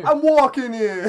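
put-on I'm walking here, New York accent. New York wiseguy joke.

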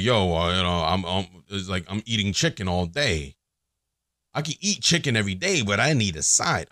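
low Italian-guy voice. Acted. Man explaining his chicken-and-sides analogy, light Italian accent. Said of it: "I'm making a guy Italian".

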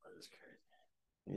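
slow hushed whisper of disbelief. Real, not acted. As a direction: breathe it out in a whisper, slowly. Quiet aside after friend's remark.

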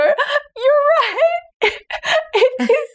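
high, breaking into laughing tears; laugh-crying surrender. Retold or imitated. Giving in to her daughter's game, laugh-crying high. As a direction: give in while laughing to tears. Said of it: "literally crying because you just go".

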